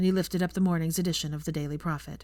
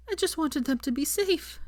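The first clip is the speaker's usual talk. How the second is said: tearful whisper; 10 semitones higher than usual. A narrator's reading. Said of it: "she whispered".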